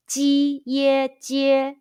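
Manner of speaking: "Ji ye jie" slow, each syllable high and level; drawn-out first-tone drill on "Ji ye jie". Shown as a demonstration. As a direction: hold each of "Ji ye jie" on one high note. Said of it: "high pitch first tone all together".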